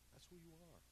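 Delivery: an unvoiced whisper pressed close. Real, not acted. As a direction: lean in and whisper it. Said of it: "whispered to congregation".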